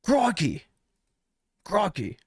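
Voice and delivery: high, excited Australian crikey; hammed-up Australian exclaim; Australian accent. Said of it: "I don't have the best Australian accent".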